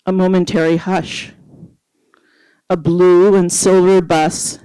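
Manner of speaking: measured, dramatic poetry recital; poem reading. Acted. Reads her poem aloud.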